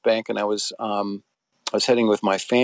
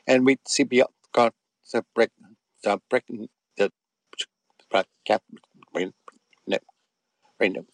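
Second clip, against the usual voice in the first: choppy phone line. Retold or imitated. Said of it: "her voice started, uh, getting choppy".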